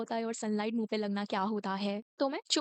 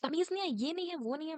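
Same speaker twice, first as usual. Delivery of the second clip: higher, mocking nagging teacher; mock scolding; 6 semitones higher, faster than usual. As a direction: parody the lecture. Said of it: "वो बोलती है कि".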